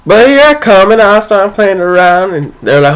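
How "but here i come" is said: ordinary delivery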